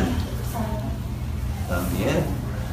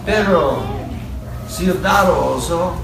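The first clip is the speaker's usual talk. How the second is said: put-on reading to a toddler. Reads picture book to child.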